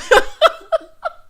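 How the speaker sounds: high, loud burst of laughter; real laugh. Real, not acted. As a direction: crack up at your own joke. Cracks up at own joke.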